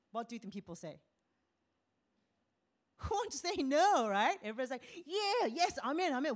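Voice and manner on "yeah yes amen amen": higher, louder eager yes; eager crowd. Retold or imitated. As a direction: cheer it like a keen crowd. People eagerly agreeing.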